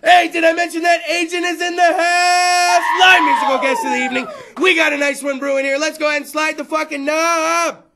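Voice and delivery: loud hyped announcer read; announcer plug. Acted. Host to listeners, plugging the show, loud announcer. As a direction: pitch the podcast like a radio host.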